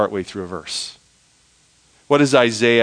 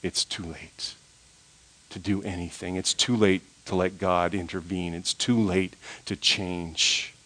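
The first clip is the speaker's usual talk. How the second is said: whispering Fear; 5 semitones lower, 9 dB quieter than usual. Acted. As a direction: drop soft and low as Fear's voice. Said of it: "Fear always whispers".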